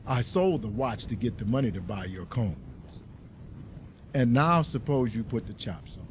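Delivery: low, soft, gentle husband; husband's calm reply. A narrator's reading. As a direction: settle her down tenderly.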